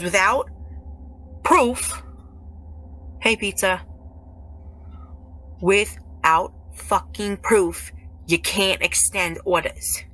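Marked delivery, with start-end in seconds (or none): 1.4-2.0 "proof" punched higher and louder; stress on proof. Real, not acted. Streamer warning absent ex, "proof" punched high and loud. 5.6-10.0 "without fucking proof" slow, each word separated; emphatic word-by-word. Real, not acted. Streamer warning absent ex about orders, "without fucking proof" slow and emphatic.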